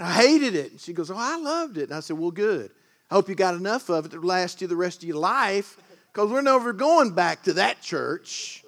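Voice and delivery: a full-voiced sermon, rising and falling; preaching. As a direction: preach it to the congregation.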